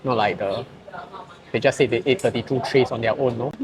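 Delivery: ordinary talk, nothing marked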